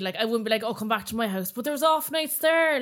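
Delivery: ordinary talk, nothing marked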